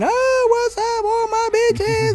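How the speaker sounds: high, slow falsetto greeting; drag host. Retold or imitated. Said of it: "here she comes out again".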